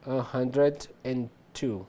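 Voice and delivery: a hundred and two spoken slowly, syllable by syllable; slow demo. Shown as a demonstration. Teacher drilling a hundred and two slowly. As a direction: spell it out slowly in speech. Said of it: "one hundred two".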